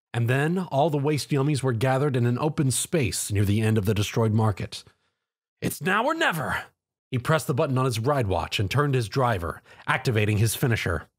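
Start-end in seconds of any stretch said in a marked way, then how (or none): 5.6-6.7 determined. Acted. Hero readies his finisher.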